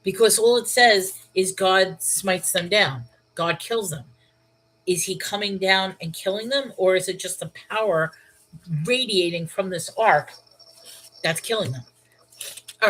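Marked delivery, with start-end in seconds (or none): none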